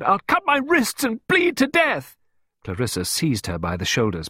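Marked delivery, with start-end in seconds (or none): 0.0-2.2 high, loud hysterical wail; uncontrollable cry. A narrator's reading. Pippa threatening herself before Clarissa, hysterical. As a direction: cry out completely out of control. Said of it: "seemed completely uncontrollable".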